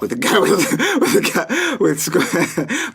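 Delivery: words broken up by laughter; can't get the phrase out for laughing. Real, not acted. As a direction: stammer it out through giggles. Breaks up while telling story.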